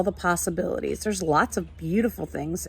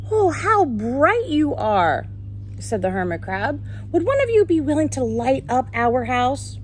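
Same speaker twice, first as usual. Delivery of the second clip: lively, sing-song storybook reading, higher than her chat; reading to children; 5 semitones higher than usual. Acted. Teacher reading a picture book to her class, animated storytelling. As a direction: read it like a picture book for little ones.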